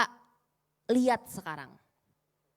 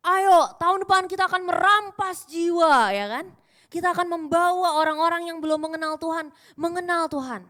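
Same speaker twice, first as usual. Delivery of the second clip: rallying cry; 6 semitones higher, 8 dB louder than usual. Retold or imitated. Imitating the senior pastor's rally.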